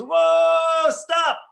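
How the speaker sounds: high, loud cry to halt; sudden shout. Retold or imitated. King halting his charging army at the old man's face: sharp loud shout. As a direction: yell it out to stop the charge. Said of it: "he goes".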